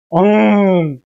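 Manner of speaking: long, loud playful "eong" roar; imitated lion roar. Preacher joking about which kind of 사자 is meant, imitated roar. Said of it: "엉 하는 사자가 아니라".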